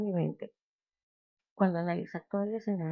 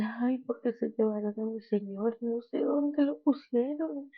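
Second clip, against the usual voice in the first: mock weeping; 4 semitones higher than usual. Mary Magdalene answering the man at the tomb, acted tearful lament. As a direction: act out a tearful plea, stretching the end. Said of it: "María Magdalena estaba llorando".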